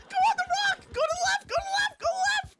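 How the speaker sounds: very high, loud frantic yelling; real panic, screamed commands; panicked shouting. Real, not acted. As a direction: shriek urgent directions in terror. Scared, yelling directions in game.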